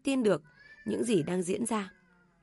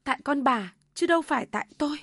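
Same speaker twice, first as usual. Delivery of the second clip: raised, higher voice of protest; inner scream; 6 semitones higher, 4 dB louder, faster than usual. A narrator's reading. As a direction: throw the blame back, quick and indignant. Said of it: "Nhài muốn gào lên rằng". (gào thầm trong lòng, cao giọng uất ức)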